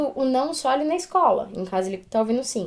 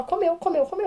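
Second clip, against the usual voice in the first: put-on crying child; 7 semitones higher than usual. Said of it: "E ficou só".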